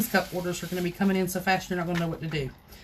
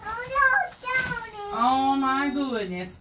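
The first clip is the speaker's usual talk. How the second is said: cooing; 5 semitones higher, much slower than usual. Real, not acted. Cooing to Tony while fetching supplies, high slow baby talk.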